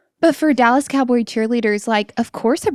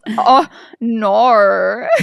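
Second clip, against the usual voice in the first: Australian supermodel; 4 dB louder than usual. Retold or imitated. Retelling the sketch's Australian supermodel: mock Aussie wail. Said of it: "he goes".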